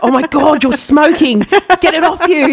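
high-pitched, jokey fake panic; mock alarm. Co-host teasing that the speaker is smoking, mock alarm. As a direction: play at being horrified, urgently.

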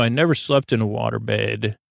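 ordinary talk, nothing marked

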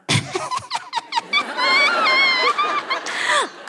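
a long, very high joyful squeal; high gleeful yelp. Acted. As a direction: squeal with laughing glee. Gleeful cry before go go go.

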